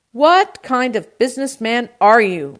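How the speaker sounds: loud, high, indignant question; shouting. A narrator's reading. Uncle berates produce man, shouting. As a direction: shout the accusation down the line. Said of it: "my uncle shouted".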